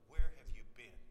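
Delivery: loud, pressing question; forceful demand. Retold or imitated. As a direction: confront them hard. Friend confronting rescuers.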